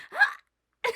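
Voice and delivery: a scream that breaks and vanishes; mock scream. Showing how her voice vanished mid-scream, a breaking mock scream. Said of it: "I screamed and my voice just disappeared".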